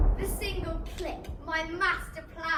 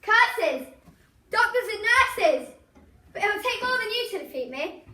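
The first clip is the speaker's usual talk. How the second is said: ranting villain; 5 dB louder than usual. Acted. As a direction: rage against the doctors. Villain thwarted, defiant.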